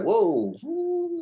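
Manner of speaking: high-pitched mock gasp of amazement; a startled, sliding "whoa, ooh"; amazed whoa. Retelling shock at same girl faking.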